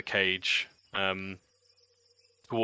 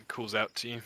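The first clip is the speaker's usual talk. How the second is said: ordinary talk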